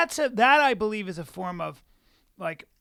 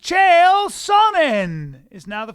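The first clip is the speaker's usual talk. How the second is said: loud, high, drawn-out ring announcement; big stretched-out showman's introduction; mock announcer; 8 semitones higher, 18 dB louder, much slower than usual. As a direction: belt out the name like a fight announcer.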